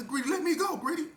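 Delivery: high-pitched mock plea; acted begging. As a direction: beg high, imitating someone grabbed. Acting Bert begging to go.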